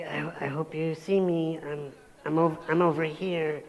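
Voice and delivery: soft, small, timid voice; a meek man in a wheelchair begging to be seen; timid pleading. Retold or imitated. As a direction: plead meekly to be noticed. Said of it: "I took on this physiology of".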